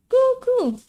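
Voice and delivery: very high, loud shriek; battle cry. Acted. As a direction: urge yourself into the attack.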